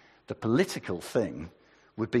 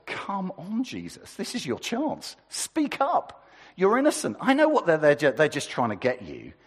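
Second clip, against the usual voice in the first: urgent pleading; 6 semitones higher than usual. Acted. Pilate pleading with silent Jesus.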